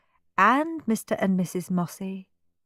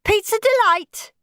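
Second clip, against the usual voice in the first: put-on high-pitched, crisp child's voice; eager high child; boy on phone; 3 dB louder than usual. A narrator's reading. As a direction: announce it brightly into the phone as a boy. Henry on the phone ordering.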